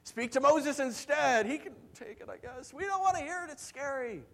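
high-pitched imitation of pleading; frightened begging. Israelites beg God at Mount Sinai, frightened pleading. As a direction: plead as if the voice terrifies you. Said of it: "they begged Moses, they begged God".

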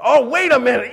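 mock dawning idea; sudden realization. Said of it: "hungry crowd realizing".

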